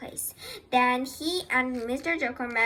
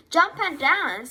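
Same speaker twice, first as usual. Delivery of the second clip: higher, louder call; raised storybook animal voice; rooster calling out; 4 semitones higher, 6 dB louder than usual. A narrator's reading. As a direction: call out the advice. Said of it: "said the rooster".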